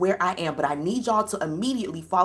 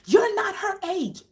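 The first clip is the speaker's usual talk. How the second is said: high-pitched mimicked indignation; imitated outraged outburst; 12 semitones higher, 3 dB louder than usual. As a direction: act out his scandalised protest. Imitates man making a scene.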